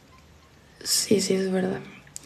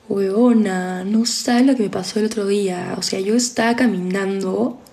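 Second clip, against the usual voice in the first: put-on drawn-out pituco Lima accent, rising at the end; Peruvian pituco accent. Demonstrating pituco Peruvian to followers, imitated pituco accent. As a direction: play a Lima pituco chatting to a friend. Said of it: "si es que es el pituquito ... sería como".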